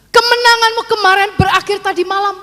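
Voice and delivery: high, ringing delivery held to the end; emphatic repeat. Retold or imitated. Repeats the saying with force. (pengulangan tegas bernada tinggi)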